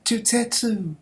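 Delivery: high, loud cry of names; mock anime wail; frantic calling. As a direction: call out running toward them. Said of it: "K O ten's running".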